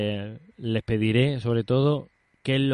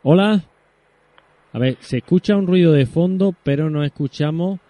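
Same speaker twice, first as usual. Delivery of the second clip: calling out; 5 semitones higher, 5 dB louder than usual. Real, not acted. Calling to a silent phone line.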